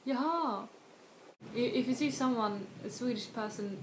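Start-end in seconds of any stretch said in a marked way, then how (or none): 0.1-0.7 "Jaha" higher, with the second syllable stressed and falling; last-syllable stress. Shown as a demonstration. Confirming new information, "Jaha" stressed on the end. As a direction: arch the pitch over the end of "Jaha". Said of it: "stress the last syllable instead".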